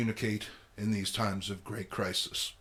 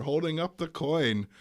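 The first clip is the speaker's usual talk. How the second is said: ordinary delivery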